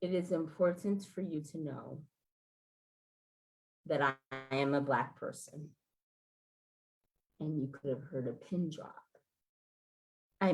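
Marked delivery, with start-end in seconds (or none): 0.0-5.8 quietly, measured and solemn; steady yourself and say it softly; grave, soft admission to a client; careful solemn statement. Retold or imitated. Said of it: "I take a deep breath".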